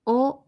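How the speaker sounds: お said short with rounded lips; a clear, round お; rounded o demo. Shown as a demonstration. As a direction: model vowel お. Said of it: "口を丸めて発音".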